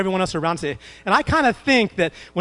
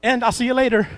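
breezy Jesus; 7 semitones higher, 4 dB louder than usual. Retold or imitated. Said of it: "And this time he says".

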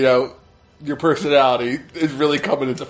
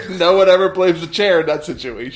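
laughing; 5 semitones higher, 5 dB louder than usual. Real, not acted. Laughing punchline.

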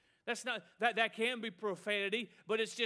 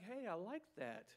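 soft imitated aside of approval; quiet approval; 11 dB quieter than usual. Preacher mimics someone noticing team colors, quiet approval. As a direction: drop the volume for a passing compliment.

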